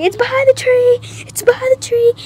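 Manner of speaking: high-pitched mock yelling; retold alarm. Child telling everyone where the scorpion went, acted alarmed yell. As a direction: call it out high, imitating panic. Shouting the scorpion's hiding place.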